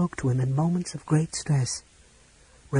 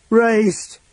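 loud, high roar of a name; 9 semitones higher, 7 dB louder than usual. A narrator's reading. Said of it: "Caramon roared".

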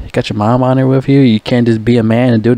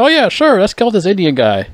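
mock eager agreement; 8 semitones higher than usual. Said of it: "And you're like".

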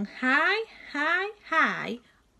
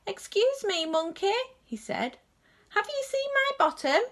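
lightly voiced, higher polite little robot; robot Bernard; 10 semitones higher than usual. Bernard politely asking Monkey, high light voice. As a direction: ask nicely, a bit higher and quicker.

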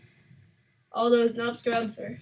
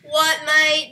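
playful fake Aussie "What, mate?" with "mate" stretched; a jokey Aussie sniper line; mock Australian; 3 semitones higher, 7 dB louder than usual. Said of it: "If you're Australian, don't get mad at me".

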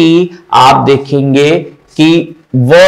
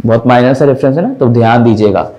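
ordinary delivery

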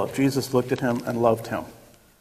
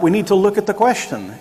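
ordinary talk, nothing marked

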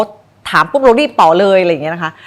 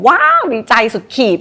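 higher, hammed-up wow of delight; over-the-top excitement; 6 semitones higher, 3 dB louder than usual. Shown as a demonstration. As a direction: act out exaggerated glee to show what not to do. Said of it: "แต่ไม่ใช่แบบ".

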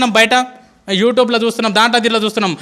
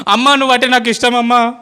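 retold wailing cry; 3 dB louder than usual. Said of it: "గొంతు చించుకొని అరుస్తూ ఉంటారు".

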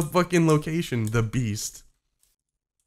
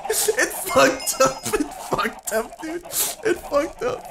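slow, high, whimpering complaint; whiny lament; 9 semitones higher, slower than usual. Real, not acted. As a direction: draw it out like near tears. Gamer laments the ruined attempt.

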